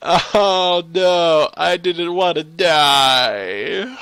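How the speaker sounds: loud, high, drawn-out wail; whiny lament. Real, not acted. Player lamenting death, loud whiny wail. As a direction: moan it in hammed-up grief.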